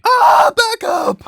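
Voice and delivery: very high, drawn-out shriek; mock scream. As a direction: scream it loud and high, stretching it out. Sudden scream amid joking remarks.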